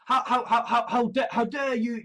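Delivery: usual speaking voice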